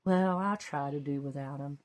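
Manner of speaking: soft, sighing resignation; heartbroken sigh. Acted. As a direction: let it out on a sad sigh. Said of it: "answered with a heartbroken sigh".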